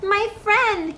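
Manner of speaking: high, small grateful mayor voice; tiny Who mayor. A narrator's reading. The mayor of Whoville thanks Horton, high tiny voice. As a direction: pitch up small and eager like a tiny townsman.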